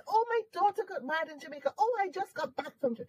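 high-pitched chatty client voice; imitated delighted caller. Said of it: "talk to somebody".

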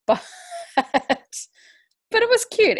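high, slow giggly drawl; laughing. Real, not acted. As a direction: laugh the words out, stretched. Laughing mid sentence.

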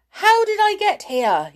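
high, loud panicked cry; squealing shout from a frightened toy; alarmed shout. A narrator's reading. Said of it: "he shouted".

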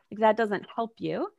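usual speaking voice

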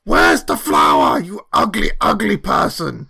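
loud, hammed-up insult; outraged in-character shouting; angry demand. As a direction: yell the demand at a foe. Shouted insult in acted quarrel.

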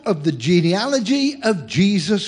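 slow, measured Bible reading; lightly solemn reading of Scripture. Acted. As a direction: read it out as holy text, slowly. Reads genealogy from Matthew.

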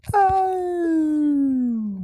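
loud, high drawn-out "oh"; mock-outraged howl; long high wail. Mock outcry at a dubious claim.

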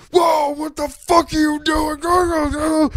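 drawn-out high alarmed yell; the dad's panicked shout as the car stalls. Retold or imitated. Dad yelling at his son when the car stalls, drawn-out shout.

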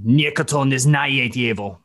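lightly voiced Russian words "Nikoto ne znaye dievo"; Russian line. Shown as a demonstration. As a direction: recite the remembered Russian line in character. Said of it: "a couple I still remember of the Russian".

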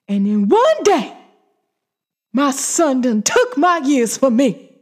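impassioned outburst, swelling loud and high; hammed-up wail of a wronged mother; anguished film mother. Vlogger re-enacting a mother's screen monologue, anguished outburst. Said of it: "when she was on that screen talking about".

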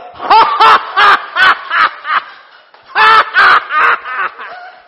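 high shouted laugh; loud hearty laugh. Real, not acted. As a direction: burst out laughing. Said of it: "big laugh".

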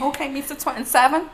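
mock him with the title. Friend mocking a man proud of his age, teasing.

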